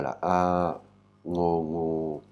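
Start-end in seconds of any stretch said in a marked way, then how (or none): none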